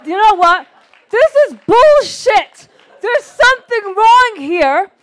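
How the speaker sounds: loud, high, slow indignant shout; angry outburst. Retold or imitated. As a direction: declare it fed up, at full volume. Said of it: "And I said".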